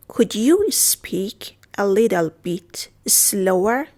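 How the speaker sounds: very slow, pausing between word groups; drawn-out teacher's demonstration; slow model repeat. Shown as a demonstration. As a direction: stretch it out for beginners to follow. Teacher repeats phrase slowly.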